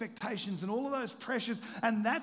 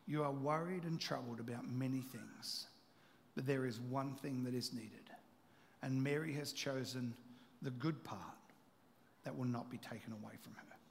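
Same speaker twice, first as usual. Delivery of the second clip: softer, tender correction of a worried friend; gentle rebuke; 6 semitones lower, 7 dB quieter than usual. Acted. Jesus to anxious Martha, a soft tender rebuke. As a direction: ease the words down gently.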